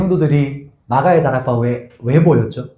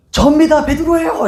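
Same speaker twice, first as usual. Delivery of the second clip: much higher, eager voice at the door; voicing Peter at the door; 13 semitones higher than usual. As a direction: call out through the door as Peter. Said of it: "이렇게 이제 하거든요".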